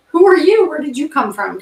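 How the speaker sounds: high, loud startled outburst at a stray cat; a sudden, surprised call of who are you; surprised question. Retold or imitated. Stray cat suddenly in her yard.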